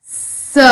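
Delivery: स as a bare voiceless hiss; just hiss स; whispered s. Shown as a demonstration. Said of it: "एस की sound क्या आ रही है?".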